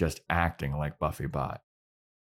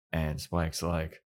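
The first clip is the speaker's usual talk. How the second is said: ordinary talk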